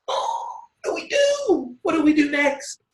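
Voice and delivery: high, gasping mock panic. Coach mocking a panicked beginner, hammed-up. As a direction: play the frantic beginner who has no idea.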